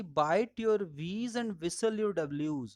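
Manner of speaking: slowly, word by word; deliberate teacher's maxim; slow rule. Shown as a demonstration. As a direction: spell out the rule for the class. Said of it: "quoted rule".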